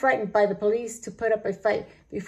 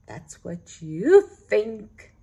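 slow, loud and defiant; drawn out, daring them; 7 dB louder, much slower than usual. Acted. Stanley defies the thieves.

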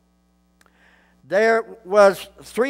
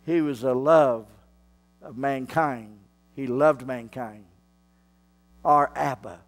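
soft and tender; 9 semitones lower, 6 dB quieter than usual. Real, not acted. Tender low preaching passage.

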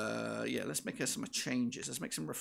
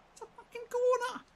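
very high, loud disbelief; outraged yell; 4 dB louder than usual. Real, not acted. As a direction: shriek at the obvious corner. Outraged at referee decision.

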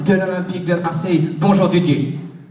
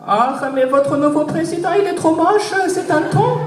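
much higher, gleeful gossip; Angela's voice; 9 semitones higher than usual. Pretend. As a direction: giggle out the insult as Angela.

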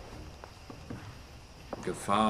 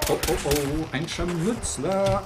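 alarmed; 8 semitones higher, 4 dB louder, slower than usual. Real, not acted. Spotting an enemy, alarmed.

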